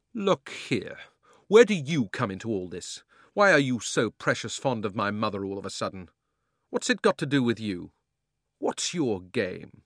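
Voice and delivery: firm, loud challenge. A narrator's reading. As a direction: stand up and confront her. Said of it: "in a different and much louder voice".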